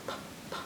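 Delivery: "p, p" as voiceless puffs; letter P sound. Shown as a demonstration. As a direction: pop "p, p" softly, no vowel. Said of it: "the letter P is p".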